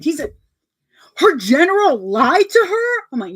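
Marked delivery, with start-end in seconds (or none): 1.2-3.0 high and loud, aghast question; imitating him, push it out scandalised; outraged disbelief. Boyfriend shocked again.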